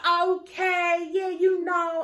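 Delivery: loud, high, drawn-out mock greeting; mock gushing greeting. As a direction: drag the words out, high and loud. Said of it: "Why are you elongating your words?".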